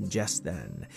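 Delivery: ordinary delivery, nothing marked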